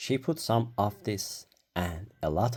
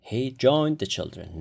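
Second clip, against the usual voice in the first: usual speaking voice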